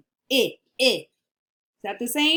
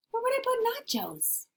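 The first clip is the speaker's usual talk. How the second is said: much higher puppet voice, softer; a high squeaky puppet wondering; 14 semitones higher, 4 dB quieter than usual. Pretend. Puppet asking the class, high voice.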